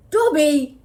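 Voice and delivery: loud recognising cry; loud surprise. A narrator's reading. Boy recognises the elf, loud and startled. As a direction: blurt out the name in shock. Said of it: "he said loudly, and then".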